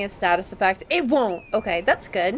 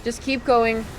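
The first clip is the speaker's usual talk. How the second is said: ordinary talk, nothing marked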